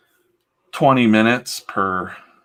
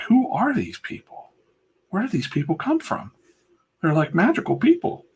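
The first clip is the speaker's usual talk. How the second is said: amazed; 10 semitones higher than usual. Retold or imitated. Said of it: "And I'm like".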